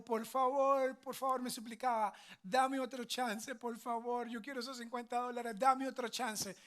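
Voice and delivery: imitating a crying child. Son begs father for another chance, mock crying plea. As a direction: beg like a kid in tears. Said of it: "Se puso a llorar".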